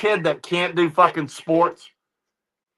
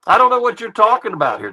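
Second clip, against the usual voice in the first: ordinary talk, nothing marked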